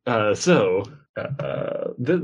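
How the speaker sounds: ordinary talk, nothing marked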